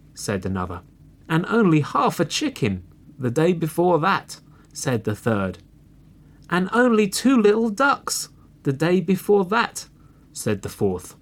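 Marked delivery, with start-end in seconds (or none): 1.3-4.4 high-pitched hungry cub; young fox voice, raised in complaint; complaining little fox. A narrator's reading. Hungry little foxes complain in turn. 6.5-9.9 very high, loud cub voice; high little fox piping up last; complaining littlest fox. A narrator's reading. Hungry little foxes complain in turn.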